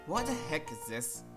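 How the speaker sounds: usual speaking voice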